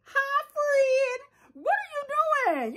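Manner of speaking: put-on high, slow sing-song baby talk, like cooing to a pet; cooing at chicken. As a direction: coo at the chicken as if it were a baby. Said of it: "She's talking about".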